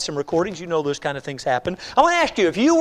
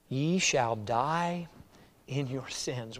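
solemn scripture reading; 5 semitones lower, 8 dB quieter, much slower than usual. Acted. Preacher quotes Jesus, John eight.

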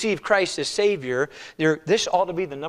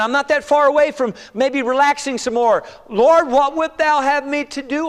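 high, loud, pleading prayer voice; earnest believer praying; 9 semitones higher, 6 dB louder than usual. Acted. Believers asking the Lord about their lives, high pleading.

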